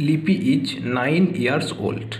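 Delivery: put-on "লিপি is nine years old" read very slowly, word by word; slow model answer. Shown as a demonstration. Teacher dictates the model answer to students, very slow and clear. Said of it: "দেখো".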